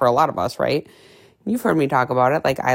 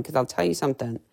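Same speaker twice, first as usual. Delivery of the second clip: ordinary delivery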